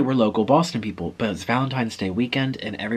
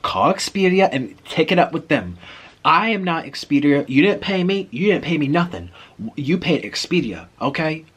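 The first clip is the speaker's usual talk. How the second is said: higher, emphatic rude clerk impression; 5 semitones higher, 4 dB louder than usual. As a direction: imitate a clerk lecturing a guest dismissively. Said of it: "the way he was saying it was so rude".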